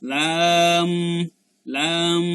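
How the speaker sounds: লাম drawn out into a held final m; lam closing lips. Shown as a demonstration. Teacher showing lips meeting at the end, held lam. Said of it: "ঠোঁট দুটো মিশে যাবে".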